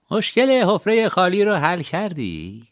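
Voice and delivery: lightly voiced old principal asking kindly; warm old principal. A narrator's reading. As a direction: smile through the question like a gentle elder. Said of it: "لبخندی گرم زد و گفت".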